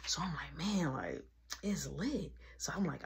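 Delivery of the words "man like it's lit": soft whispered hype. Retold or imitated. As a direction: whisper it to a friend. Whispered to friend at party.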